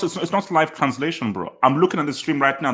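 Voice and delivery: ordinary talk, nothing marked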